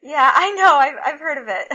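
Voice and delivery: retold chuckle breaking into the words; chuckling. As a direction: laugh through a knowing answer. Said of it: "And I kind of chuckled".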